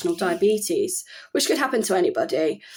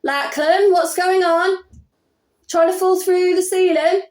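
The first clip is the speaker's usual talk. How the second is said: calling out; 10 semitones higher, 5 dB louder than usual. Real, not acted. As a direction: shout up to your son through the ceiling. Calling upstairs to banging son.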